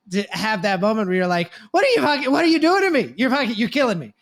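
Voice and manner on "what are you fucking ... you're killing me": higher, louder mock exasperation at the kid; exasperated outburst. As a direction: throw up your hands, fed-up parent.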